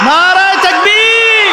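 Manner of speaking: high, long-drawn rallying cry; slogan shout. Real, not acted. Preacher calls congregation to respond, a high drawn-out shout. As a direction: call out the slogan to a whole crowd, slowly.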